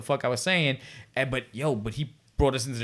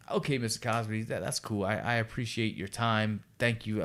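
lower, softer, deferential thanks; subdued politeness; 5 semitones lower, 7 dB quieter than usual. Retold or imitated. Young extra thanking star after long lecture, subdued politeness. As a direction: back out politely, keeping it small.

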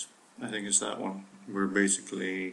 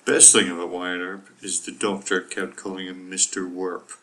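usual speaking voice

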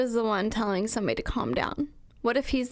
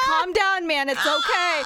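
raised, loud comic reassurance; Bigfoot; 6 semitones higher, 5 dB louder, slower than usual. Pretend. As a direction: shout mock comfort over the screaming. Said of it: "Bigfoot's like".

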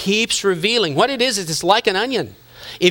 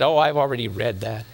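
lightly voiced dismissive teen, low; a hint of a know-it-all youth; imitating bored girl; 8 semitones lower, 3 dB quieter than usual. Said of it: "He said".

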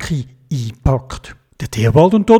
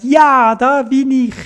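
put-on raised child voice, bright and eager; Theobald's voice; 10 semitones higher, slower than usual. A narrator's reading. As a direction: pipe up like a little boy. Theobald answers in story. (höhere Kinderstimme)

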